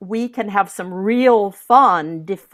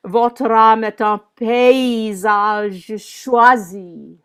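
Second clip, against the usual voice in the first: slow French line, leaning on the first syllables of paysage and choisi; declaimed verse with insistance opening paysage and choisi; rhythm of the words; 3 dB louder, slower than usual. Shown as a demonstration. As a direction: stretch the "pay" of paysage and the "choi" of choisi. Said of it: "sing the rhythm of the words".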